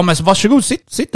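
high-pitched sarcastic offer; retold mock politeness, pitched high; mock invitation. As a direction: act out a pointed "be my guest". Mockingly offers the wet seat.

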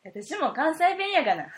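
loud, exaggerated Kansai dialect declaration; hammed-up Kansai dialect. Co-host teasing back in exaggerated Kansai dialect. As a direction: lay the Kansai dialect on thick, playfully. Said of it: "コテコテの関西弁で参りますよ".

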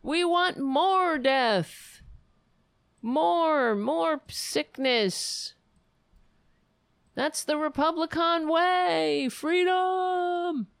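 high, slow sarcastic protester voice; mock protester. Streamer mocking a vaccine protester, high and hammed-up. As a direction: ham up a zealous anti-vax protester.

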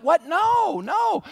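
very high, drawn-out refusal; wailing comic denial; mock disbelief. Disciples ignoring the prophecy, high-pitched disbelief. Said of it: "and they're like".

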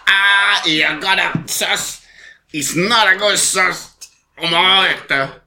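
high, slow mock outburst; hammed-up drawn-out protest; Duncan Bannatyne impression. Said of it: "he look at them and he goes".

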